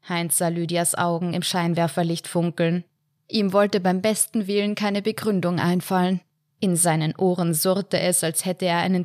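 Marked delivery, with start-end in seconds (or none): none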